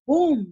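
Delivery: pum said high and loud; bang sound. Shown as a demonstration. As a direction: pop it out like a bang.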